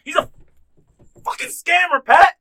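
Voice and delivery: high, loud exasperated punchline; outraged yell. Real, not acted. Revealing to Pat the rich guy scams, yelling. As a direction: shout the reveal.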